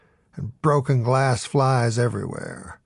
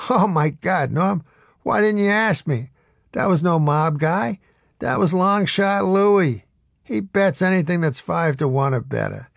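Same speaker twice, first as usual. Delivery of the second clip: a lightly voiced amused bookie, higher; laughing it off easily; calm seen-it-all; 6 semitones higher than usual. A narrator's reading. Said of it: "in his calm, seen-it-all voice".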